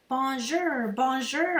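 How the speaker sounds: high squawky mynah bird calling bonjour. A narrator's reading. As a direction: play a parroting mynah bird, high and loud. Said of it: "in bird voice".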